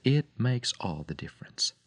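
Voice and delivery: hushed, breathy close; whisper. Real, not acted. Radio preacher signing off, whispered. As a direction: lean in and breathe it out. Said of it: "sign-off whispered".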